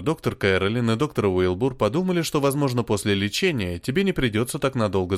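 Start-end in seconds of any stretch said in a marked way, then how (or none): none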